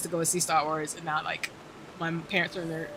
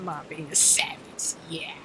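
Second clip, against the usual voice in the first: laughing whisper; 5 semitones higher, 7 dB louder, slower than usual. Real, not acted. Laugh then whispered aside.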